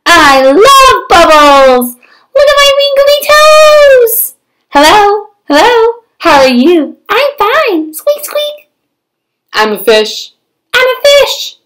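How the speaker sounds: high, loud and gleeful; delighted pigeon. Acted. Pigeon happily playing in the bath, high and gleeful. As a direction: bubble over with joy in the tub.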